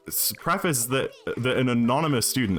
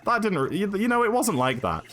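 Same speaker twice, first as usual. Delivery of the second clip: mock defensive protest; 11 semitones higher than usual. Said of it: "and she's like".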